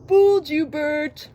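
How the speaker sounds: high, loud gotcha; Ernie. A narrator's reading. Ernie rubs in the prank, high and gleeful. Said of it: "laughed Ernie".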